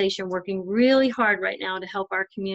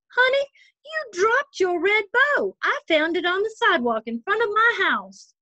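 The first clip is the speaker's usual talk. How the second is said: much higher, louder, eager news on the phone; grandma exclaiming; 12 semitones higher, 3 dB louder than usual. Acted. Grandma on the phone telling the girl about the bow, excited. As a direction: call out like a grandma who found something. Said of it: "heard Grandma's voice exclaim".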